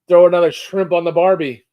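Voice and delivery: put-on Aussie catchphrase with hardly any Australian accent; failed Aussie accent. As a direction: attempt an Aussie accent and miss completely. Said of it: "zero Aussie accent".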